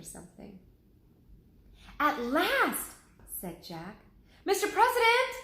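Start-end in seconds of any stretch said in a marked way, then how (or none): 2.0-2.9 high, loud cry of relief; finally, there he is; excited exclaim. Acted. Jack spots the president at last: loud relieved cry. 4.4-5.3 high, loud call across the grove. Acted. Annie calls to the president across the grove: loud high call. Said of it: "Annie called".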